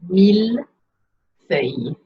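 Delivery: "Millefeuille" slowly in two parts, meel, then fuh-y with a Y glide; slow, split "Millefeuille", L sounded in mille, Y sound ending feuille; French pronunciation drill. Shown as a demonstration. Teacher explaining a tricky word to pronounce, slow split French pronunciation. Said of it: "is a Y sound".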